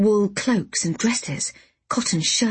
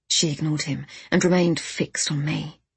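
plain reading voice

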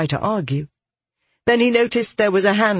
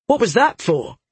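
higher, indignant question; demanding; 7 semitones higher than usual. A narrator's reading. Soldier just slapped by the girl, demanding. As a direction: demand an explanation. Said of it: "he demanded".

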